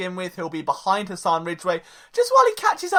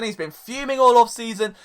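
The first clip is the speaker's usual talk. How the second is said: normal talking voice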